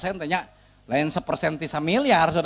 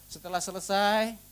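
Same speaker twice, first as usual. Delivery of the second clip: usual speaking voice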